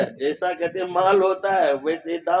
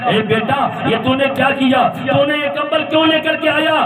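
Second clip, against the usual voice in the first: preaching at full force; 10 semitones higher than usual. Acted. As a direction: preach the tale with rising fervour. (جوش سے بلند آواز میں واعظانہ قصہ گوئی)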